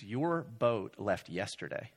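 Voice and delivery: slow, soft breaking of bad news; compassionate. Check-in worker telling honeymooners they missed the boat, compassionate. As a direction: let it down gently and slowly. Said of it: "her eyes just have such compassion".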